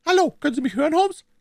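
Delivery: high, loud, sharp shout; sharp waking call. A narrator's reading. Smith tries to wake the sick Holmes, sharp call. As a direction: rouse a sleeper with a piercing question. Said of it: "in dem scharfen Tone jemandes".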